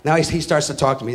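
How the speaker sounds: ordinary talk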